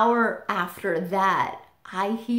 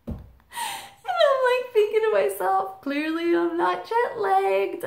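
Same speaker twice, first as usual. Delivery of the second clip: laughing while telling; 12 semitones higher than usual. Real, not acted.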